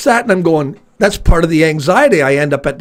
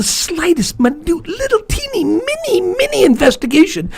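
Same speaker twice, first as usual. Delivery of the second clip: tiny squeaky emphasis; 12 semitones higher than usual. Real, not acted. Exaggerating smallness.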